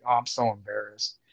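low, soft, embarrassed admission; sheepish. Retold or imitated. Dealer owning up to his idol, sheepishly embarrassed. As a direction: shrink the voice down, abashed.

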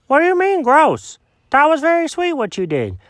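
high, bright protesting dog voice; Alfred protests to Jenkins, high and earnest; surprised dog. Acted. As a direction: insist up high that it was sweet. Dog protests the cat's words.

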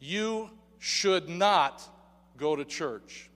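NOT drawn out slowly; "You should not" said very slowly with weight on not; stress on NOT. Shown as a demonstration. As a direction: lean hard on "not", slow and deliberate. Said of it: "listen carefully to this statement".

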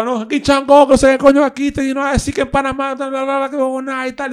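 high, loud, hurried mock patter; voz imitada del tipo. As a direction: rattle it off high as the stage guy. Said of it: "El tipo". (imitando al tipo, agudo y fuerte)

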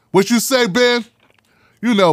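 ordinary talk

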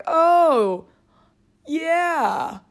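put-on loud, high hard-of-hearing old man; kindly old man. Cow as old man asking about a sandwich, kindly and loud. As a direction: play a kind old fellow who can't hear. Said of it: "like, old men, but not the grouchy ones".

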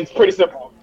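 ordinary talk

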